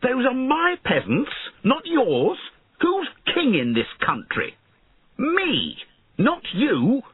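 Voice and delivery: high-pitched, indignant outburst; outraged young king, voice very high; angry shout. A narrator's reading. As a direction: assert your rank angrily. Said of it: "he shouted angrily".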